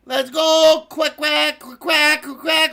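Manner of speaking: loud, playful duck cheering; excited quacking. Pretend. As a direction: cheer the ducks on with rapid-fire quacks. Cheers on the ducks.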